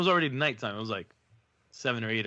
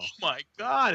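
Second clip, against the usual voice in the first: higher, mock shocked oh my God; mock dismay; 9 semitones higher than usual. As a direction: gasp it out in embarrassment. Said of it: "And I'm like".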